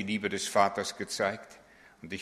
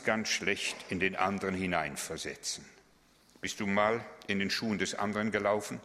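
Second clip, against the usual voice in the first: ordinary talk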